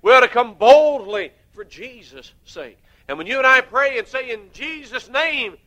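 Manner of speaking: loud, high preaching to the congregation; fiery preaching. Acted. Preacher urging the congregation to look at God's promises, loud and driving. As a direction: hammer it home from the pulpit.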